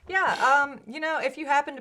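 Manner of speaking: excited. Real, not acted. Excited sign-off burst.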